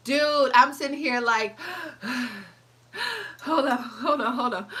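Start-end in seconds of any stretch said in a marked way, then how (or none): 2.4-4.8 mock winded plea, sighing heavily before and after; acted out of breath. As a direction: imitate huffing between words.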